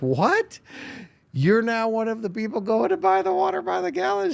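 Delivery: high, disbelieving question; husband stunned his wife still buys the water; incredulous. Retold or imitated. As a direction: squeak out the disbelief. Retold disbelief at wife's confession.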